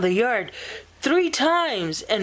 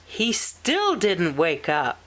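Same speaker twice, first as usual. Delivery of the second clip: ordinary delivery, nothing marked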